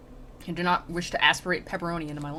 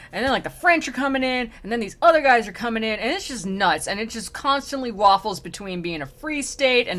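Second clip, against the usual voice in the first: loud, worked-up mock rant; exasperated rant; 3 semitones higher, 6 dB louder than usual. Dante tells a spirit about his homeland's turmoil, exasperated rant. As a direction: reel off the chaos, fed up. Acted rant on Romagna's endless wars.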